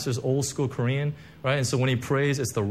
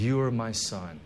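softer, lower, tender; voice of heaven; 5 semitones lower, 5 dB quieter than usual. Pretend. Pastor voicing God's words over the congregation, tender and soft. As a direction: speak as God to his child. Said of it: "the thunder of heaven ... that says".

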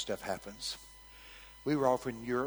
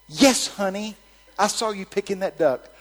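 higher, raised-voice pronouncement; loud delivery; 8 semitones higher, 10 dB louder than usual. Retold or imitated. Preacher passing God's message to the granny, raised voice. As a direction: announce it loudly to her.

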